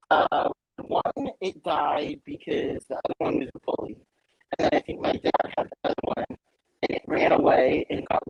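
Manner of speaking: glitchy robotic audio; robot voice through a broken mic. Real, not acted. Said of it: "You sound like a robot".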